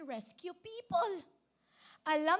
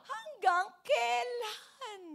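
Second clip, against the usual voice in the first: high, loud, long-drawn cry; stretched-out, raised wail to heaven; anguished shout; 7 semitones higher, 5 dB louder, much slower than usual. Shown as a demonstration. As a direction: shout it slowly as a desperate plea. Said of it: "Sigaw natin".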